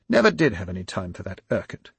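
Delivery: plain reading voice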